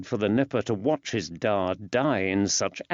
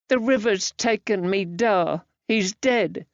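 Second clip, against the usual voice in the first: put-on high child's voice, heavy with grief; a small boy telling of his father's death; grieving boy; 9 semitones higher than usual. Grieving boy after crying.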